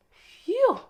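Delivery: loud high phew of disgust. Acted. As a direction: blow out at a bad stink. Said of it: "feet smelled worse".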